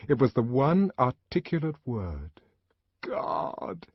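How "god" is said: soft whispered prayer; the savage whispering in grief. A narrator's reading. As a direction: breathe the word out. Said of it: "he whispered it aloud".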